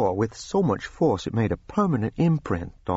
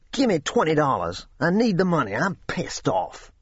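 angry demand; 7 semitones higher than usual. A narrator's reading. Angry boy demanding money.

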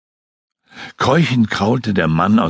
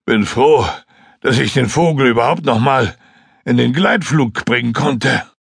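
higher, eager flier's voice; excited pilot reliving the fall; Joskun recounting; 5 semitones higher than usual. Acted. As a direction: tell the crash story in a rush. Joskun relieved about gliding.

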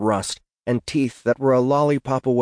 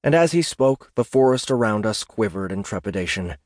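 ordinary delivery, nothing marked

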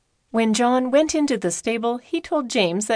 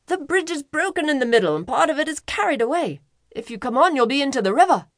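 urgent warning; 6 semitones higher, faster than usual. Acted. Toll-keeper warning of broken bridge.

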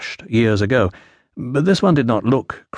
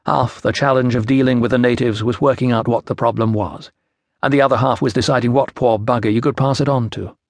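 usual speaking voice

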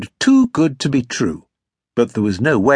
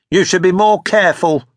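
sour, higher-pitched reproach; 4 semitones higher, 4 dB louder than usual. A narrator's reading. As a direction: scold sourly in a raised pitch. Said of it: "in a sour voice".

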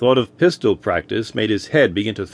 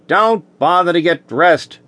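sharp, higher impatient bark; snapped; 5 semitones higher than usual. A narrator's reading. Chief snaps at Malone over the intercom, curt and impatient. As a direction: cut him off, curt and raised. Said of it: "Burris snapped".

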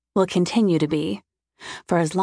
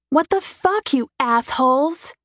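shrill furious scream, much higher; screaming at speeding bikers; 6 semitones higher than usual. A narrator's reading. Woman alone on a mountain road screaming at bikers, furious. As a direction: shriek it out in rage. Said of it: "I scream it".

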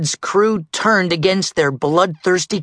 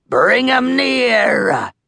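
high, long drawn-out command; pirate captain's order; 10 semitones higher, much slower than usual. A narrator's reading. Captain Red ordering the ship nearer, drawn-out command. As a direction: stretch the order out slowly. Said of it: "Red ordered".

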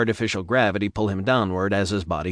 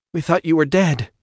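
a touch of tears, higher and strained; holding back tears; 8 semitones higher, 4 dB louder than usual. A narrator's reading. Devon hugging her friend thought dead, holding back tears. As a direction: fight the tears without letting them out. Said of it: "fighting to hold back her tears".

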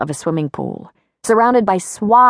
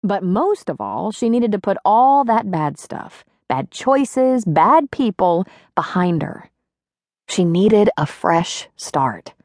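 ordinary delivery

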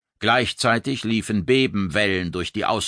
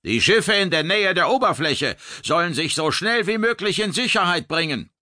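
high, steady raised command; commanding over noise; 10 semitones higher than usual. Acted. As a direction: project calm authority through the noise. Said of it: "mit seiner Stimme etwas Ruhe in das Chaos zu bringen".

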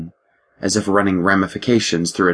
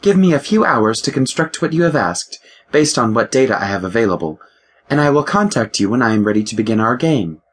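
higher, crisp voice of a ship's computer; lightly voiced ship AI, precise and higher; 4 semitones higher than usual. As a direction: play the ship's mind, a touch superior. The ship AI speaks.

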